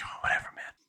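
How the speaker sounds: whispered brush-off; dismissive whisper. Retold or imitated. Dismissing the guru.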